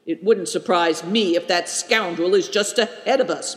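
ordinary delivery

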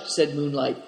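usual speaking voice

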